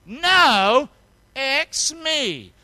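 loud and high, leading the crowd; loud call-and-response. Shown as a demonstration. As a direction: shout it out with the congregation. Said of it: "One more time".

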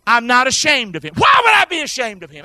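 fervent preaching shout. Real, not acted. Preacher shouting to the congregation, fervent shout. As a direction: proclaim it with full force.

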